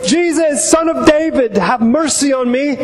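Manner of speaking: high, pleading call for mercy; crying out. Acted. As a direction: call out as a blind beggar, pitched well up without extra volume. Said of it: "he began to cry out and say".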